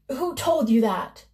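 mildly fierce questioning; demanding. Acted. Baba Yaga demands who told Vasilisa, mild fierce demand. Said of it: "she demanded".

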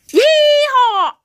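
high, loud cowboy whoop; whooping yeehaw. Pretend. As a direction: holler it like a rodeo cowboy. Cowboy cry.

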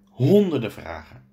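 plain reading voice

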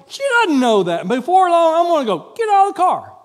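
a driver's fake fury building to a shout. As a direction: climb until you shout. Escalating mock car argument.

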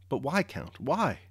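pleading question, a touch of distress; understated near-tearful appeal; almost crying. A narrator's reading. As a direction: plead for an answer, pitch lifting. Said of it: "she almost cried".

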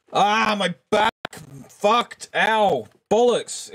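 high yelps over a sore back; pained cry. Real, not acted. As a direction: cry out in short, high bursts of pain.

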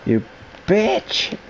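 mock heroine, pitch leaping on the insult; pause, then toss out the last word high; Sigourney Weaver. Player echoes the film line, mock heroine. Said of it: "That's just how Sigourney Weaver talks".

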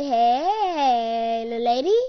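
loud, drawn-out mock greeting; mock catcall. Greeting a little lady, playful mock catcall. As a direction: call it out with a swooping hey. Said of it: "And you're like".

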